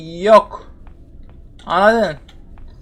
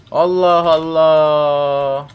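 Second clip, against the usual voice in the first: very slow, drawn-out Allah Allah; weary drawl; much slower than usual. Real, not acted. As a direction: stretch each syllable in tired disbelief. Still no gasoline anywhere.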